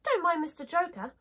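high princess voice, dismissive; china princess. Acted. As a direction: brush the clown off in a high little voice.

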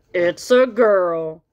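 slow, loud proud father; proud dad. Acted. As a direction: announce it slowly like a proud dad. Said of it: "said Froggy's dad".

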